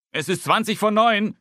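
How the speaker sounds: Papa calls from the hall; calling from the hall. A narrator's reading. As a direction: shout the time from the hallway. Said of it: "ruft Papa aus dem Flur".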